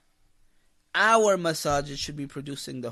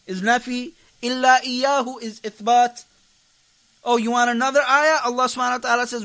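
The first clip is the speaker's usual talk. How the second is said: louder, driving preacher's voice; fiery preaching; 7 semitones higher, 9 dB louder, faster than usual. Acted. Preacher urging the brothers to memorize, full-force preaching. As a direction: hammer each point home to the brothers.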